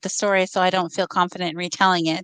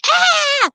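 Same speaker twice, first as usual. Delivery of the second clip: high, loud imitation shriek; mimicked scream; 5 dB louder than usual. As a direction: copy the creature's scream back at it. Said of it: "trying to mimic the sound that it was making and screaming".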